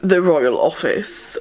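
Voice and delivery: slow, plummy imitation of his posh English; mocking his put-on posh accent. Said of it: "why is he put that accent on?".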